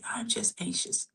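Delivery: "I'm just anxious" breathed out in a soft whisper. Shown as a demonstration. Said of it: "let me show you what I mean here".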